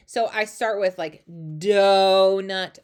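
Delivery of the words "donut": "donut" stretched very slowly, first sound loud. Shown as a demonstration. Teacher checking the beginning sound, "donut" in slow-mo. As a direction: draw out "donut" as if in slow motion. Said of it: "I do it in slow-mo".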